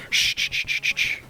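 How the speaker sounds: repeated soft "shh" sounds; playful hushing. Pretend. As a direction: hush a victim in mock comfort. Mimics the hushing sneak attacker.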